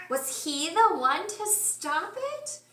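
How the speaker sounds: plain reading voice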